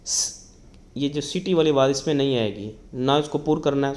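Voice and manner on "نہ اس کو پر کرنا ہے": normal talking voice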